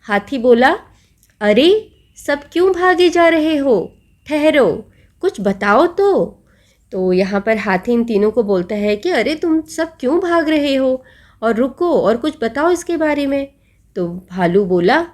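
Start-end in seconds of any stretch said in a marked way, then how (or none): 1.4-6.4 raised, higher voice calling the runners to stop; elephant calling out. Acted. 9.1-13.5 elephant calling out. Acted. Elephant's words retold.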